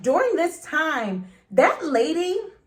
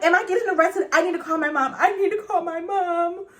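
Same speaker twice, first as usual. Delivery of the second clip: panicked plea; 7 semitones higher, 3 dB louder than usual. Retold or imitated. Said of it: "I'm like".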